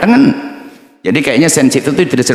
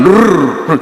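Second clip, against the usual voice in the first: train rumble; 6 semitones higher than usual. Retold or imitated. Imitating train passing at night.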